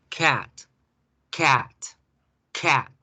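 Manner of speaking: "cat" with a short open A; short flat A in cat. Shown as a demonstration. Teacher demonstrating the short A in "cat". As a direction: say "cat" with a quick, open A sound. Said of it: "La primera".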